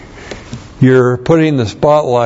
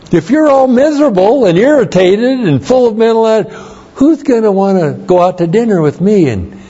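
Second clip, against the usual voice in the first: playful Paul; 8 semitones higher than usual. Retold or imitated. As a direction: ham up Paul's complaint for a laugh. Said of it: "And then he adds this".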